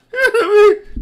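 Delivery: high squealing laugh. Real, not acted. Bursts out laughing.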